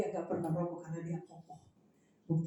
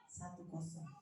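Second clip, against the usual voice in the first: very soft, lower aside; low private mutter; inner voice; 4 semitones lower, 15 dB quieter than usual. Retold or imitated. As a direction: keep score quietly to yourself. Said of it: "Saya dalam hati".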